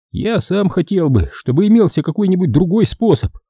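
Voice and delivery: high-pitched, regretful character voice; sad doctor. A narrator's reading. Doctor regrets there is no other way, sad high voice. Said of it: "грустно сказал врач".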